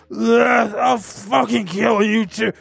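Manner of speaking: put-on slow, drawn-out drunken threat; groan, then drag the words out drunkenly; drunk neighbor. Imitating the neighbor who's a drunk mess, slurred mock threat. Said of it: "he's just drunk".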